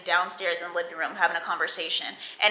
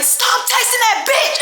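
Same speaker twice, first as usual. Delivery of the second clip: much higher, louder jealous yell; mock angry yell; 4 dB louder than usual. Jealous at girlfriend, high angry yell. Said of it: "And I was like".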